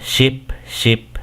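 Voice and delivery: ship said in English; English "ship" with a short i; English suffix. Shown as a demonstration. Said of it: "De la siguiente manera". (pronuncia "ship" en inglés)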